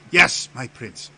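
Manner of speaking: loud, startled courtier's reply; flustered obedience. Acted. Theus answers his prince's warning, flustered.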